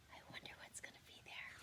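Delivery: a fast, hushed whisper. Real, not acted. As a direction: secret storyteller whisper. Aside to listening children.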